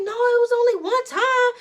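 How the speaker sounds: high, pleading whimper; scared kid. Retold or imitated. Daughter pleading with angry mom, high and scared. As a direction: plead like a frightened child. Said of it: "I'm just like".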